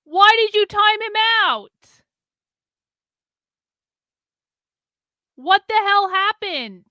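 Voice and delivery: loud, drawn-out indignant yell; yelling at someone. Real, not acted. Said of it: "Sorry, I'm yelling at Sin".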